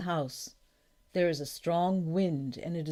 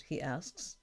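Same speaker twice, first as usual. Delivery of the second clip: ordinary delivery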